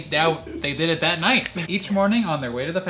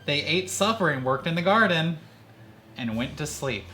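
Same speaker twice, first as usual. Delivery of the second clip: ordinary talk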